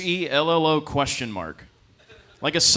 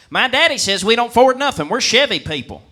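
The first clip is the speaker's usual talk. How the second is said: put-on higher and louder country boy voice; 11 semitones higher, 10 dB louder than usual. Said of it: "And he goes".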